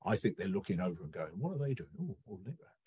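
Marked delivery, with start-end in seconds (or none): 1.4-2.8 soft, much quieter sneaky murmur; hushed wolf muttering. Retold or imitated. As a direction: peek over and whisper it. Wolves peering over, hushed.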